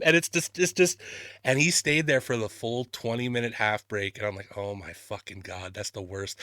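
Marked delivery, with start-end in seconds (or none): 4.6-6.4 low, very soft aside; dismayed undertone. Retold or imitated. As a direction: say it under your breath in dismay. Retelling dismayed reaction to sad scene.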